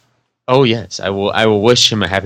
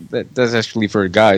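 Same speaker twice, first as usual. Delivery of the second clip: normal talking voice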